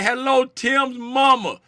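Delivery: usual speaking voice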